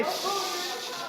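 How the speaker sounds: high hey, then long shh; long shushing. Real, not acted. Speaker quieting a rowdy audience, insistent shush. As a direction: hush the room with a drawn-out hiss.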